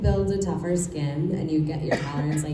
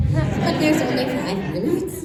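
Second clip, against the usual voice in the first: laughing, high and slow; 9 semitones higher, 6 dB louder than usual. Real, not acted. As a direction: laugh through the words. Laughing reply to "we are trying.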